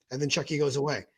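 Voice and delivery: ordinary delivery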